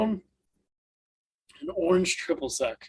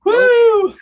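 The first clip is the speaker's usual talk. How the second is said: whoop; 12 dB louder than usual. Real, not acted. Whooping after taking a shot.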